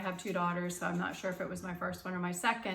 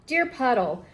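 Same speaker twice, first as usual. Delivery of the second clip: lively, louder storybook read-aloud; reading to children; 7 dB louder than usual. Acted. Teacher reading a picture book to students, lively storytime voice. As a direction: read it brightly for the class.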